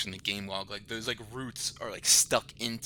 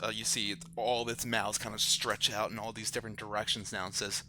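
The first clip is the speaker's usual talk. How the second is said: ordinary talk, nothing marked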